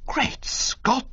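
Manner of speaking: breathy high exclaim; shocked under the breath; startled gasp. Acted. Bertie to Jeeves, breathy startled gasp.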